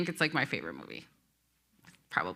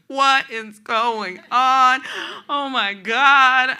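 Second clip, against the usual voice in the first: slow, much louder, high cry of disbelief; overwhelmed disbelief; 7 semitones higher, 11 dB louder, much slower than usual. Retold or imitated. In the coffee shop as the family prays over her, crying out. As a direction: come apart in awe as strangers pray over you. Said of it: "I am losing it".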